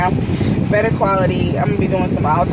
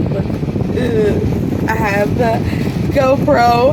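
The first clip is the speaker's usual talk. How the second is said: excited outburst; 2 semitones higher, much slower than usual. Real, not acted. Vlogger showing off her new camera, excited outburst. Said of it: "I just needed to let that out".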